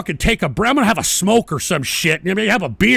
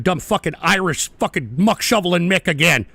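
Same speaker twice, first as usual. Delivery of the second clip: ordinary talk, nothing marked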